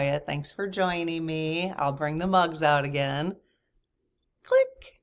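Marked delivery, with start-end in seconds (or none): 4.4-5.0 mock click sound. Mimicking a sound effect.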